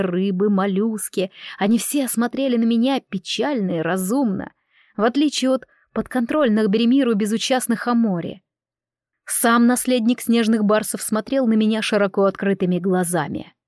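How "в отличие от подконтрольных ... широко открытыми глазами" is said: usual speaking voice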